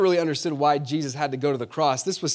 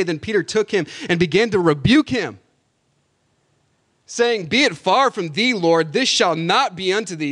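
louder, urgent sermon voice; rising preaching; 4 semitones higher, 5 dB louder than usual. Acted. Pastor voicing Peter's protest to the church, urgent. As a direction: build the fervour back up.

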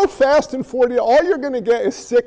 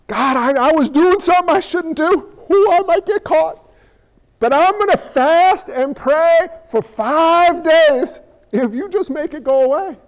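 hammed-up frightened plea, higher; mock guilty prayer; 4 semitones higher than usual. Preacher mimicking a sinner's nervous prayer, higher. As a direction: whine a bargain to God.

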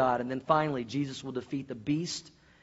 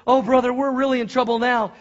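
high, loud wail of trouble; acted alarm, voice raised; panicked older brother; 7 semitones higher, 7 dB louder than usual. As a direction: warn your brother in a panic. Scared brother in joke.

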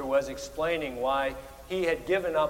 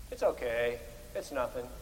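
low, soft brush-off; understated, lowered shrug of reassurance; mock dismissive; 4 semitones lower, 5 dB quieter than usual. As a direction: act out waving away an apology. Acts out brushing off an apology.

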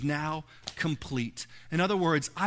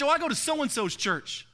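imitating a churchgoer; 7 semitones higher than usual. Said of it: "I hear it all the time".

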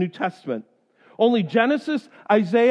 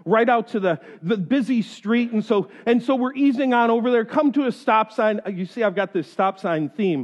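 ordinary talk, nothing marked

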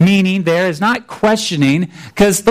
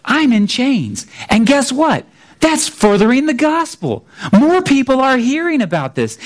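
higher, bright, cheerful news; upbeat and unbothered; 6 semitones higher than usual. Retold or imitated. Paul telling the Philippians his chains spread the gospel, upbeat. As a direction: shrug off the chains and sound glad. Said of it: "He just says".

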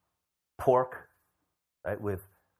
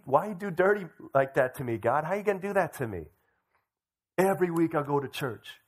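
mildly aggrieved, acted demands to God; entitled complaint; 6 semitones higher, 3 dB louder than usual. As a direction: complain as if you are owed. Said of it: "then we feel entitled".

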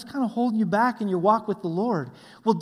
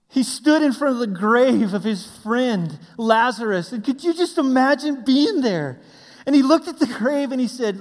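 loud, raised storytelling from the pulpit; impassioned preaching; 6 semitones higher, 8 dB louder than usual. Acted. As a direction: preach it big, full of awe. Preaches at full force.

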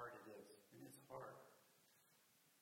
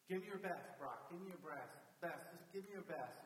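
louder, higher coach pushing a player on; retold coach's raised-voice urging; 4 semitones higher, 4 dB louder than usual. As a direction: keep driving him on, insistent. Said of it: "the coach just keeps going".

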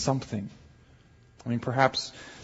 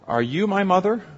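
pitched up high like a small chick; baby bird voice; 7 semitones higher, 4 dB louder than usual. Acted. Baby bird asks the dog in a high little voice. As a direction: ask it as the lost baby bird, high and hopeful.

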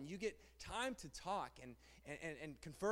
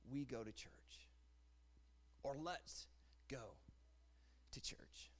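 fading quiet quote; 6 semitones lower, 11 dB quieter, slower than usual. Acted.

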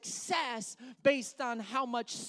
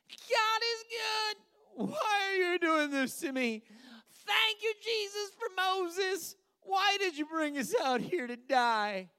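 high, slow praise turning to loud whine; mock fickle worshipper; 8 semitones higher, 4 dB louder than usual. Preacher acts fickle believer swinging from praise to wailing. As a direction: swing from praise into crying complaint.

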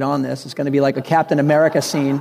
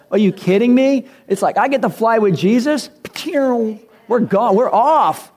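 thrilled disbelief; 7 semitones higher than usual. Retold or imitated. Preacher acting out a thrilled believer, hammed-up joy. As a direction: gush like a kid given a treat. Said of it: "And it's like".

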